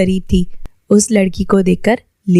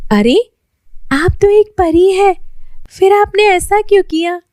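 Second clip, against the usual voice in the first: put-on higher, astonished girlish voice; Lily astonished the girl is a fairy, higher voice; amazed girl; 10 semitones higher than usual. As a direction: stare in wonder at the fairy.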